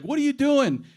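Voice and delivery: high, loud indignant shout; angry driver yell. Retold or imitated. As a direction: bark it like the furious driver.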